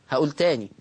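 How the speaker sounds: ordinary talk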